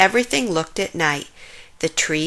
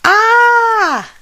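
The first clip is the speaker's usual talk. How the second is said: very high, loud startled shriek; scream; 10 dB louder than usual. A narrator's reading. Jim grabbed around the leg in the dark, shrieking. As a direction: shriek in sudden fright.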